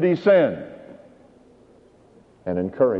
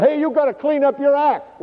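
high, pushy voice of a preachy church member; a busybody telling a new convert to shape up; mock scold; 13 semitones higher than usual. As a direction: lecture him from above, imitating the busybody.

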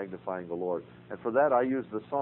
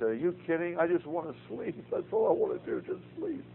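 exhausted protest; 8 semitones higher than usual. Retold or imitated. Retold reply to wife, exhausted.

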